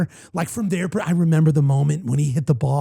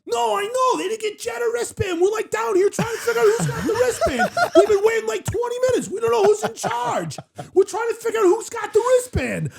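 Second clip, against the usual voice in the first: flustered Joey Coldcuts voice. Retold or imitated. Stuck at the stairs with no wristband for Jet, much higher flustered yelling. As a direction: play him all worked up and shouting in his character voice. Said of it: "flustered and doing the voice".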